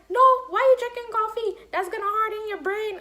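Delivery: high, loud mock grandma scolding. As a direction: squawk it like an outraged grandma. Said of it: "She's like".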